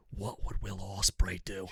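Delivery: soft, low whisper in his ear. Retold or imitated. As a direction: breathe it into his ear, hushed. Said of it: "she whispered in my ear".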